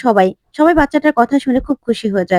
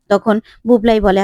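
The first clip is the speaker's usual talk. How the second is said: usual speaking voice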